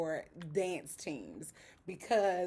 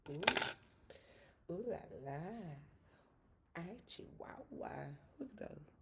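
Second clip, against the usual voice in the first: whispered exclamation; 3 semitones lower, 11 dB quieter than usual. Real, not acted. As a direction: hush it like a secret joke. Joking exclamation about lashes.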